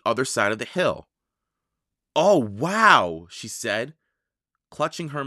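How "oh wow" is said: louder wowed exclamation; swooping awe; amazed. A narrator's reading. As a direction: let out an impressed wow. Girl amazed at what she sees.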